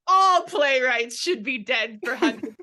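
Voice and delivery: laughing through a loud haughty retort; mock haughty quote. Friend retelling a movie burn, laughing loudly. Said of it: "And she was like".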